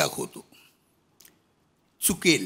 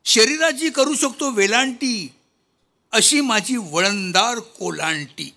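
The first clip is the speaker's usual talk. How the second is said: loud declaimed verse with raised pitch; poem recitation; 4 semitones higher, 5 dB louder than usual. Acted. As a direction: declaim comic verse to the hall. (विनोदी कविता वाचन, मोठ्या आवाजात)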